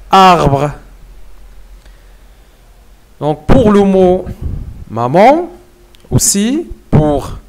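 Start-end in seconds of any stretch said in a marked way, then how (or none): none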